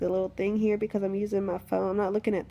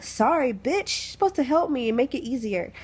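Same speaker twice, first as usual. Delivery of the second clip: higher-pitched, acted-out indignation; mock indignant retort; 5 semitones higher than usual. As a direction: snap back at the nurse in mock outrage. Acts out retort to nurse.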